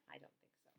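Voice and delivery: breathy hushed whisper; a spooked little whisper; whispered reply. Acted. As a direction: whisper it as if scared to be heard. Character answers about the ghost.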